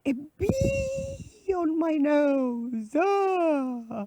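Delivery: high, drawn-out storybook scream; goat's hammed-up shriek at a bee; mock scream. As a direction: stretch the words into a high wail. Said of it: "then he screamed".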